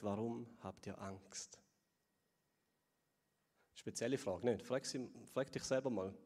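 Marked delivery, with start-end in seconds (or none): 0.0-1.6 low, soft and slow; Jesus asking the disciples, tender and quiet; soft gentle question. Acted. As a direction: let the question land kindly. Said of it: "Jesus fragt".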